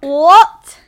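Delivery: loud, high, rising what; can't believe what she heard; stunned disbelief. Real, not acted. Two friends reacting to an absurd confession, incredulous. Disbelief at belly button story.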